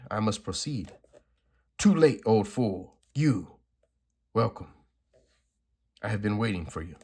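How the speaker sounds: lightly acted comic-book dialogue; dramatic comic reading. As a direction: read the panels like a dramatic script. Reads comic dialogue aloud.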